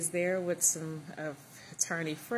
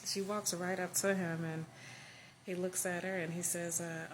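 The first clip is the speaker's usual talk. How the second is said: ordinary delivery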